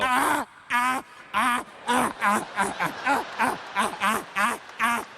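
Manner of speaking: rhythmic mock guttural laughter; rhythmic imitated heckler laughter from the front row; mock Klingon laugh.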